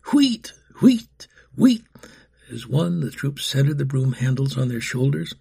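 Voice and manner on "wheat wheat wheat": 1 whistle call imitation. A narrator's reading. Whistle signal, crew marches.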